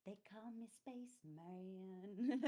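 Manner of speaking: slow sultry croon. Retold or imitated. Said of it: "puts on this sultry voice".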